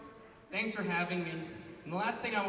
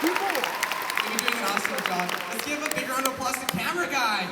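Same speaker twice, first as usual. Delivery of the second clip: hype cheer; 4 semitones higher, 5 dB louder than usual. Real, not acted. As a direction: pump up the crowd for the crew. Hyping the crew to the crowd.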